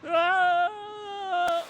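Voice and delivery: sharp high yelp of pain; pained shout. Acted. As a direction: cry out as you fall. Character falls crying out.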